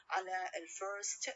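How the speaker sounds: usual speaking voice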